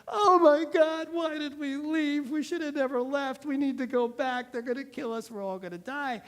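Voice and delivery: high, loud mock panic that fades to the speaker's own voice; hammed-up panic. Israelites panicking at Moses by the sea, hammed-up fear. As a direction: play frightened Israelites, shrill at first, then trailing off.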